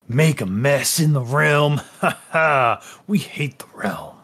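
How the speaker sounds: a lightly voiced, leering fellow inmate greeting the new guy; a sly jailbird with a chuckle; slimy prisoner. Unnamed prisoner voice.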